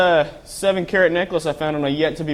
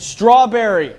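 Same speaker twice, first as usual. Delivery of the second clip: high, loud order; shouting a drink order over to his partner; calling out; 6 semitones higher, 6 dB louder, slower than usual. Acted. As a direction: call it out across the room.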